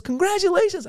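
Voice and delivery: very high sing-out cheer; hammed-up excited well-wisher; gushing congratulations. Club booker congratulates new regular, high gushing delight.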